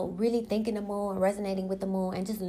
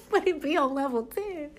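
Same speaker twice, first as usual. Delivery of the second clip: laughing; 6 semitones higher than usual. Real, not acted. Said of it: "I'm usually like that".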